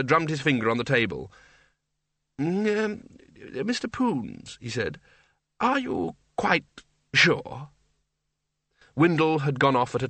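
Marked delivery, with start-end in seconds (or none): 2.4-4.6 high, tentative throat-clearing address; stall, then say it high and carefully; hesitant Archchancellor. A narrator's reading. Archchancellor tactfully addressing Windle, hesitant. 5.6-7.7 high, slow, careful question; tentative, with a pause before the last word; hesitant Archchancellor. A narrator's reading. Archchancellor tactfully questioning Windle, hesitant.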